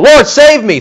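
a high, loud cry for help. A narrator's reading. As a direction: call out like a sinking man. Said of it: "He cried out".

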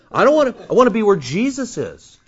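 ordinary talk

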